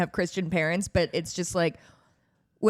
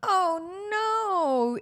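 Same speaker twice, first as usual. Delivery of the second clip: high, shocked "oh no"; 11 semitones higher than usual. Real, not acted. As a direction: gasp it out in dismay. Shocked reaction to news.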